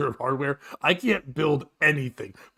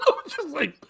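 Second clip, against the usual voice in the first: ordinary talk, nothing marked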